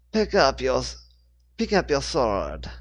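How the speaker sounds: lightly voiced French accent; a hint of French swordswoman. A narrator's reading. Swordswoman orders Rachel in a French accent. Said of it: "in her thick French accent".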